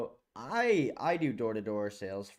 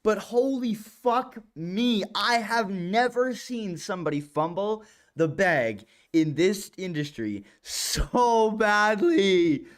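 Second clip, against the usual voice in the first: slow, louder, stretched-out disbelief; drawn-out disbelief; 7 semitones higher, 6 dB louder, slower than usual. Real, not acted. Salesman reacting to a fumbled door pitch, stretched and loud. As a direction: lean on each word in horror.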